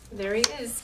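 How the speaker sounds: usual speaking voice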